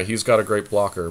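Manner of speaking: ordinary talk, nothing marked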